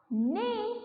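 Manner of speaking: high neigh; whinny for the class; horse neigh. Shown as a demonstration. Teacher answers how the horse sounds with a neigh. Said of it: "how the horse sound?".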